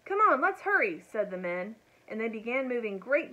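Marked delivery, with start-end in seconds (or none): 0.1-1.0 high, loud rallying call; urgent urging. A narrator's reading. The men urge each other to the rescue, urgent. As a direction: hurry the others along urgently.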